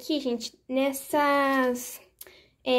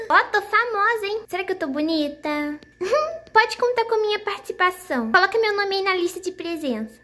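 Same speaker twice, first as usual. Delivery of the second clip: put-on high, thrilled childish voice; high giggly kid voice; excited little girl; 6 semitones higher than usual. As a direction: show off like a proud kid. Doll thrilled to be famous.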